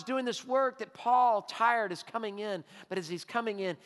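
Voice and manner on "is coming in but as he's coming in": normal talking voice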